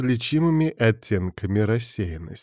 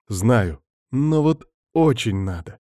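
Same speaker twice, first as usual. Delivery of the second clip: ordinary delivery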